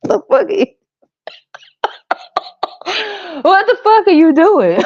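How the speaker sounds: mock horrified reaction; shocked outburst. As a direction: yelp it in shock.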